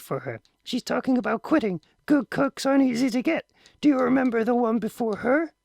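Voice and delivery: wife and husband bickering, voiced high; mother and father voices. A narrator's reading. Wife argues with husband over the cook, high and loud.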